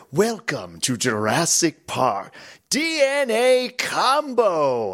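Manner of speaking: slow booming game announcer, pitch jumping high at the end. Retold or imitated. Imitating the Jurassic Park call-out guy to the hosts. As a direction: draw out the call-out like a showman. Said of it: "the guy that's really good".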